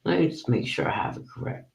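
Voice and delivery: quiet muttered self-check, no voice; whispered aside. Real, not acted. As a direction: whisper it to yourself while checking. Checking the title to herself.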